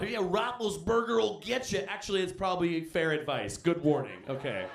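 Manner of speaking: usual speaking voice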